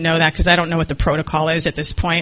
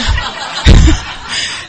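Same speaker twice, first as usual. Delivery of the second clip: laugh; 8 semitones higher than usual. Real, not acted. Laughing at the escape plan.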